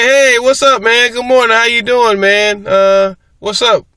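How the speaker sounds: loud, high, eager hello to a friend; hearty greeting. Retold or imitated. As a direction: call out a big friendly hello. His own loud cheerful greeting.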